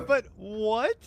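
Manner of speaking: high puzzled but what; surprised. Real, not acted. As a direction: laugh through the disbelief. Caster reacts to surprise.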